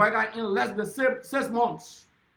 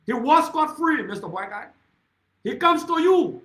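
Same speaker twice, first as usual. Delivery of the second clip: angry shout; 6 semitones higher, 5 dB louder than usual. Real, not acted. Accusing the president at a rally.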